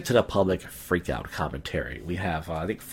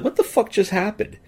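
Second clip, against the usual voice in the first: high-pitched incredulous reaction; retold bewilderment; 13 semitones higher than usual. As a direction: re-enact stunned disbelief, pitch way up. Retelling his bewildered reaction.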